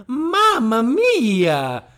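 put-on slow hammy Italian accent. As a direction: stretch it out like a cartoon Italian chef. Italian food ad gag.

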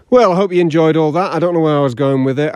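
normal talking voice

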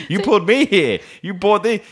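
high-pitched indignant protest; mock indignation. Retold quarrel at the bar.

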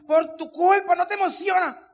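high, loud mock scolding; angry accusation. Husband at the seminar scolding wife, angry accusation. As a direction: imitate an exasperated blaming outburst.

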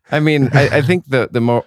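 ordinary talk, nothing marked

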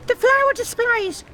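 high, anxious blurt; sudden worry. Acted. Fretting wife to her calm husband, anxious high cry. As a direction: remember it in a flash of panic. Anxious committee member checking preparations.

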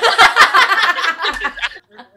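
long loud burst of laughter. Real, not acted. Laughing loud at the absurd date. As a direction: howl with real laughter.

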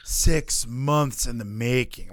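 slow, low, word-by-word recital; mock dictating a scripted line; scripted line delivery. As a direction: spell out the bullet point slowly and deliberately. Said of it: "they really wrote it down for her".

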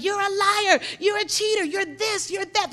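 high, loud finger-pointing accusations; acted accusing. Angry people label each other in fights, acted high accusing.

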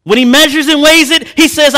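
loud, high, ringing pulpit delivery; preaching at full force. Acted. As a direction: preach it to the congregation. Preaching climax.

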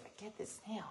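very soft, secretive grandpa voice; barely voiced, setting up the trick; hushed build-up. Grandpa with the kids right up close, hushed.

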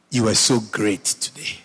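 ordinary talk

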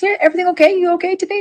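imitating a concerned friend. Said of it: "And she goes".

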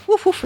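high hooting woof woof like a barking owl; owl call imitation. Answering a barking owl at night, high woof call. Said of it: "I go, went".

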